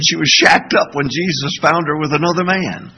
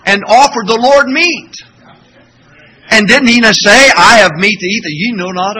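1 loud preaching; 5 semitones higher, 8 dB louder than usual. Real, not acted. Preaching build.

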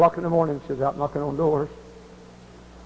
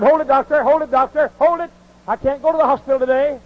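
higher, urgent woman's plea; pleading woman; 10 semitones higher, 4 dB louder than usual. Retold or imitated. Woman begging her doctor to wait, higher urgent plea. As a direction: beg the doctor to wait, pitched up.

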